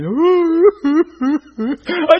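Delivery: sudden high sob bursting out; crying out. Real, not acted. Preacher breaking down in tears before the Lord. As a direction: break into real sobbing. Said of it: "just start crying".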